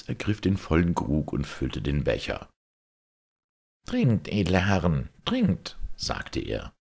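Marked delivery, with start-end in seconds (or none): 3.8-5.8 a lightly raised, cheerful farmer offering wine; hearty peasant host. Acted. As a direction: urge the lords to drink. Peasant Hans pours wine.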